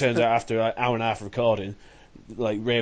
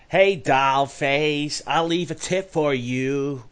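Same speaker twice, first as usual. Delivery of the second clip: very slow, drawn-out, higher-pitched American put-on; half-decent American accent; 4 semitones higher, 3 dB louder, much slower than usual. Shown as a demonstration. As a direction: drag it out in a jokey American accent. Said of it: "I can do a half decent American one".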